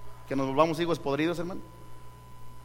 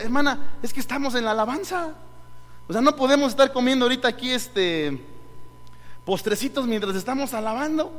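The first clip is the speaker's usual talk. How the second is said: higher-pitched, exasperated reproach; mock-pleading objection, pitched up; retold protest; 5 semitones higher than usual. Pastor to sister handing out desserts during worship, protesting.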